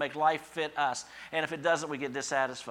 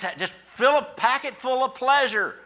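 mocking; 12 semitones higher, 9 dB louder than usual. Mocking pleasure seekers.

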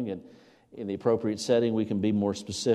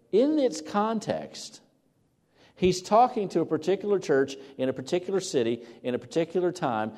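emphatic preaching; 6 semitones higher, 3 dB louder than usual. Acted. Preacher urging his church to see the context, raised and insistent. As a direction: press the point on the congregation.

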